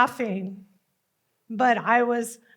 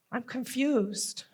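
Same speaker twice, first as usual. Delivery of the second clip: ordinary delivery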